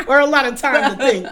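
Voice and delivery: laughing it off. Real, not acted. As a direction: joke through a laugh. Laughs at herself.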